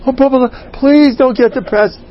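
high, imploring mock plea; pleading parent. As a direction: beg the child not to sulk. Parent begging the child.

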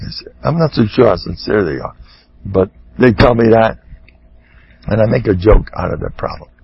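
usual speaking voice

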